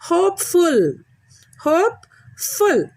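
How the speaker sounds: "Hopeful" high and deliberate, HOPE stressed, syllables set apart; first-syllable stress. Shown as a demonstration. Teacher shows children "Hopeful", stressed on first syllable. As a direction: hit HOPE in "Hopeful", then separate "ful". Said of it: "you should stress on the first syllable".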